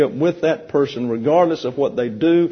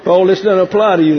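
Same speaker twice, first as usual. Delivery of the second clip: laughing; 4 semitones higher, 6 dB louder, faster than usual. Real, not acted. Teasing Paul over the mishap.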